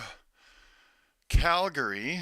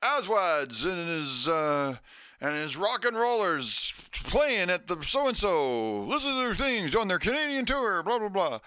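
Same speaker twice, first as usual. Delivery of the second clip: higher, hyped-up rock radio announcer; mock radio promo read; 5 semitones higher than usual. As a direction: sell the gig like a DJ. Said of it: "they're like".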